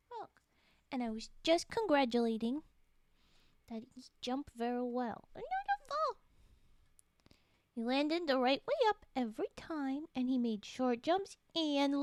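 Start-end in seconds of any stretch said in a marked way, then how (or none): 5.4-6.2 high, urgent little plea; high pleading. Real, not acted. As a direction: beg it not to fall. Pleading with game character.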